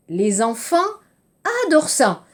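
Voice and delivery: falling exclamation on "adorent ça", pitch jumping up on "adorent"; exclamation intonation. Shown as a demonstration. Teacher demonstrates exclamatory intonation to students, falling firmly. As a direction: model an exclamation for the class to repeat. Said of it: "l'intonation, c'est une exclamation".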